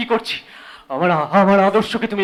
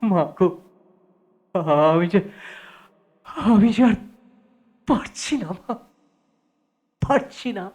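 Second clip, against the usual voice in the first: breaking down; 4 semitones lower, 3 dB quieter than usual. Acted. Son breaks down.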